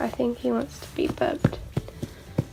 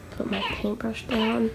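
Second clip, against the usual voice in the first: ordinary talk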